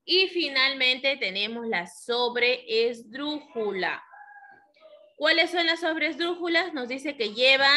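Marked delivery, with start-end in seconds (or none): none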